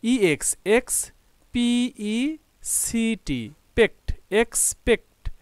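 teacher slowly building "এক্সপেক্ট" from its syllables; syllable-by-syllable spelling. Shown as a demonstration. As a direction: spell "ইএক্স, পিইসিটি" letter by letter, then sound out "এক্স-পেক্ট". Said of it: "syllable ভাগ করে পড়ি".

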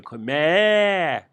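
"me" bleated high and loud like a sheep; bleat demo. Shown as a demonstration. Teacher imitating a sheep to show the m sound. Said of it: "koyun M'si gibi".

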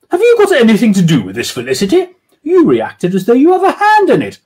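man voicing a questioning woman; suspicious lady. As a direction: accuse her friend lightly. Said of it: "doing women's voices".

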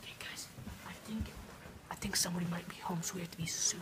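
hushed sneaking whisper. Pretend. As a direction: whisper so nobody hears. Sneaking, somebody might be home.

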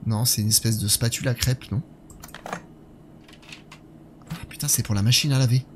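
ordinary delivery

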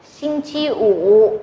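"Sinh chi ủ" very slow with a rising "chi"; slow teacher demo of "Sinh chi ủ"; slow model reading. Shown as a demonstration. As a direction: drill "Sinh chi ủ" slowly for students. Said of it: "tiếng Trung".

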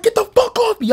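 very high, strained cry of protest; mock scream. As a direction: imitate screaming without full volume. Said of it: "I'm screaming".

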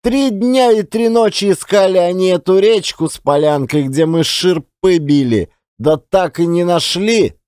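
ordinary delivery

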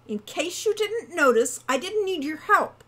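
high, loud scornful retort. A narrator's reading. Hawk rejecting Wren's help, scornful. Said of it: "said Hawk scornfully".

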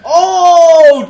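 very loud, high, drawn-out whoop; elated shout. Real, not acted. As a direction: whoop it out in triumph. Celebrating a double kill.

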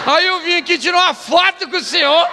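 high, loud overjoyed admirer; hammed-up excited imitation; mock delight. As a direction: gush it out like a thrilled fan. Same acted man, delighted at accident.

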